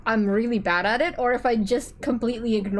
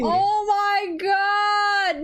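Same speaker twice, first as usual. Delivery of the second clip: drawn-out wail; 8 semitones higher, much slower than usual. Real, not acted. Panicking about offending friend.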